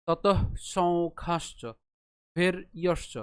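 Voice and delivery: slow split ততঃ সংখ্যাশ্চ ভের ইয়শ্চ, a gap after ভের; broken into parts. Shown as a demonstration. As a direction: separate ভের from ইয়শ্চ clearly. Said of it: "ভেঙে উচ্চারণ করি". (ভেঙে ধীরে উচ্চারণ)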